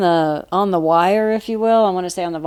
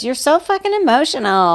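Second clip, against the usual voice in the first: higher, scornful put-on voice quoting a critic; mocking accuser; 6 semitones higher than usual. Quoting someone who called women emotional, mock scorn. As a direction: sneer it like the person who judged her. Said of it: "Somebody said to us".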